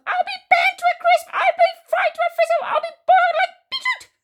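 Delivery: high, loud frantic wail; yelling. A narrator's reading. Grandma complaining of the burning, frantic high yell. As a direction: shriek each complaint at full pitch. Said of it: "she yelled".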